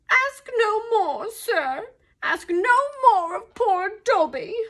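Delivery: high, loud, drawn-out plea; distressed elf. A narrator's reading. Dobby refusing Harry's questions, pleading in distress. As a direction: beg in a high, anguished elf voice. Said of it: "stammered the elf".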